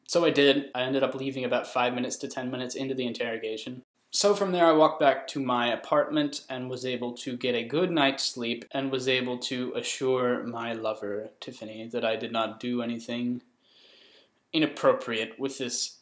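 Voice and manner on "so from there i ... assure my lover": normal talking voice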